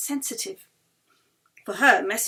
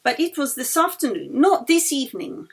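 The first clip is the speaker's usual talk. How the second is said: high, rising, louder protest; insistent; 7 semitones higher, 7 dB louder than usual. A narrator's reading. Yeli protesting to the circle, high and insistent. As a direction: brush it off with rising force. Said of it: "Yeli insisted".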